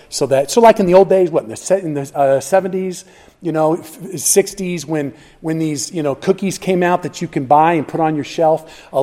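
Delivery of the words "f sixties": ordinary talk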